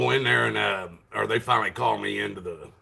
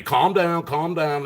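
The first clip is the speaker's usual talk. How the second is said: higher, louder mock scolding; mimicked calming down; 7 semitones higher, 5 dB louder, slower than usual. Officials telling a loud talker to settle, mimicked. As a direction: imitate people nagging you to settle. Mimicking those who tell him off.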